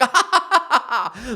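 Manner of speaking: high, manic Joker cackle. Retold or imitated. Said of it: "she starts laughing".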